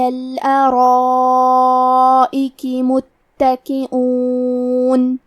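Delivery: very slow, drawn-out Quranic chant; Quran recitation. Acted. Teacher recites the verse for students, very slow chant. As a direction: recite slowly, stretching every vowel.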